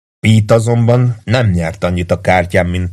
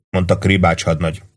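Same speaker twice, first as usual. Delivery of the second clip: usual speaking voice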